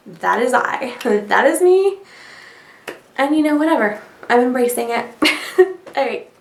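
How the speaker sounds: ordinary talk, nothing marked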